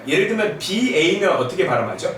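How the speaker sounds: ordinary talk, nothing marked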